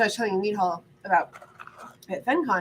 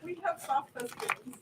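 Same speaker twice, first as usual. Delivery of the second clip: pet talk; 8 semitones higher, 7 dB louder than usual. Real, not acted. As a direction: coo at the pet. Talking to pet baby-voice.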